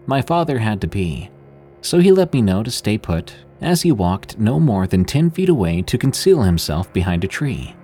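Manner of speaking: ordinary delivery, nothing marked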